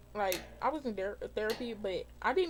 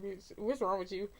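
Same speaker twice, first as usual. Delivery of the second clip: ordinary delivery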